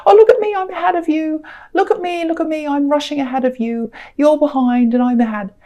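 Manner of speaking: acted gloating taunt, sing-out start; mocking runners. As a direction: crow like a show-off overtaking someone. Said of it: "they're kind of laughing. They're saying".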